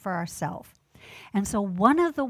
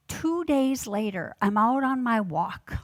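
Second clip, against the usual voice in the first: ordinary talk, nothing marked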